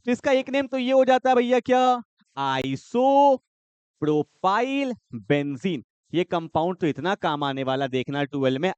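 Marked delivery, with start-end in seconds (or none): 2.6-5.8 isopropyl benzene stretched out very slowly; slow, deliberate dictation. Shown as a demonstration. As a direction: draw out every syllable so students can write it. Dictating a name to the class.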